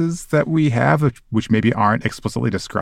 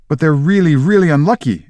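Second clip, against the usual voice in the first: higher-pitched acted worry; alarmed; 5 semitones higher, 3 dB louder than usual. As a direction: interrupt as an alarmed pupil. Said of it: "looking alarmed".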